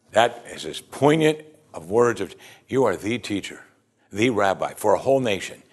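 ordinary talk, nothing marked

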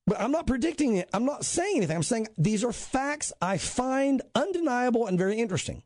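ordinary talk

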